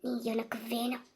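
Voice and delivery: low, near-whispered determination; hushed vow. Acted. Lover vows to win the beloved back, hushed and low. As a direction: murmur it low and soft, like a secret vow.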